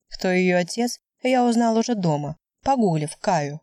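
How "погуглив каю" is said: ordinary delivery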